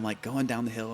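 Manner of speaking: ordinary talk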